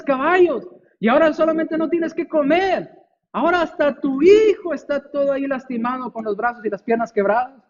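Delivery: high, overwrought lament; wailing neighbours.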